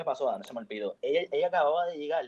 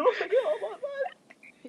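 very high, slow comic whimper; mock crying; slower than usual. Sobbing onto his best friend, mock crying. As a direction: squeak it out through exaggerated sobs. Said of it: "Y me le tiré encima a llorar".

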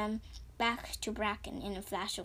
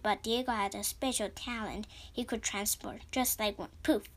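usual speaking voice

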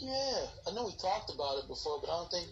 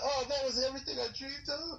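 higher, mock-swooning gush; dreamy fanboy; 9 semitones higher than usual. Friend echoing the movie line, hammed-up dreamy. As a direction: play the starstruck character again. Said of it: "tell me about it".

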